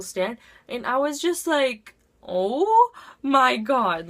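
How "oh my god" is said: loud, slow, drawn-out disbelief; mock shocked. Student peeking in at crying teacher, exaggerated shock. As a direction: stretch it out, loud and aghast.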